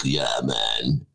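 low, laid-back video clerk; Gen Xer. Retold or imitated. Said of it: "He was a Gen Xer".

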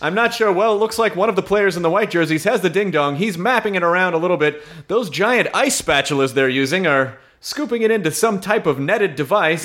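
high, bright play-by-play call; sports announcer. Acted. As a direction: announce it like live TV. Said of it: "start announcing".

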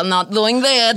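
put-on Russian accent. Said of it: "Just Russians who are here".

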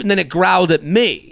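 ordinary delivery

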